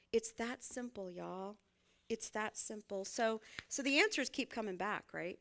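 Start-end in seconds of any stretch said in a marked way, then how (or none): none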